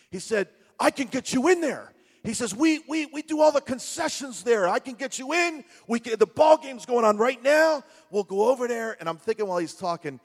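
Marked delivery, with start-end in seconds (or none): 0.8-1.9 put-on Brooklyn accent. Retold Brooklyn guy boasting. 2.6-9.0 put-on Brooklyn accent. Retold Brooklyn guy boasting.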